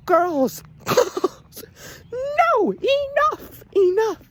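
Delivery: very high, squealed protest; hammed-up tearful exasperation; mock despair. Reviewer begs girls for enough fake death scenes, mock despair.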